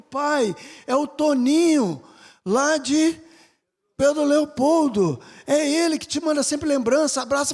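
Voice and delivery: loud, high eager telling; excited son. Retold or imitated. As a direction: blurt out the news to dad. Son excitedly telling his father.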